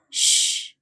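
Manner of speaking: soft unvoiced shh; hush. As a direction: gently shush her to calm her. Hushing the crying girl. (ಮೃದುವಾಗಿ ಶ್ಶ್ ಎಂದು ಸಮಾಧಾನಿಸುವುದು)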